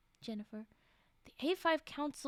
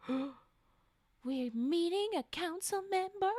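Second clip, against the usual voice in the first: astonished; 5 semitones higher, 5 dB louder, much slower than usual. Acted.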